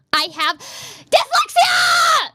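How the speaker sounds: high, drawn-out yelled outburst; yelling. Real, not acted. Streamer protesting jibes about their grammar, yelling. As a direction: yell it, stretching the last word. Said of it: "Sorry for yelling at you".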